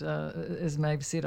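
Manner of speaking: ordinary talk, nothing marked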